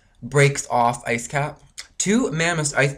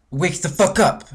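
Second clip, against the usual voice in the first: loud, higher command with FUCK hit hard; angry shout; 4 semitones higher, 4 dB louder than usual. Real, not acted. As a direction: bark it at the listeners. Frustrated rant at people.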